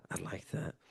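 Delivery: sighed aside. Real, not acted.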